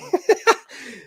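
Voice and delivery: high giddy squeal of a laugh. Real, not acted. Streamer squealing with joy over Valyrian glyphs. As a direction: burst into a delighted high laugh.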